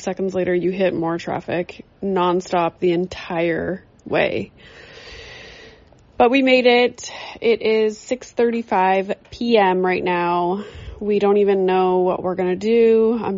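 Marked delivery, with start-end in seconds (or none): none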